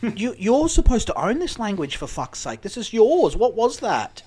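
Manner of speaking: loud, high exasperated rant; hammed-up disbelief, raising the voice; mock outrage.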